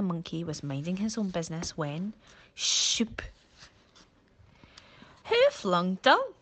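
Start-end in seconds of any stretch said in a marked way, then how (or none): 2.6-3.2 a quick whispered shoop, like dung flying; splat sound. Acted. Narrator makes the dung-splat noise, a whispered shoop. 5.3-6.3 much higher, louder outraged question, rising at the end; indignant monkey. Acted. Monkey asks who threw the dung, high and outraged. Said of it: "Furley said".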